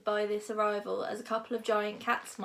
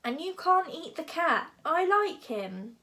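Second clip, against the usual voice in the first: higher, exasperated protest; losing his calm; 7 semitones higher, 4 dB louder than usual. A narrator's reading. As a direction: forbid it as patience runs out. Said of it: "feeling all his calmness deserting him".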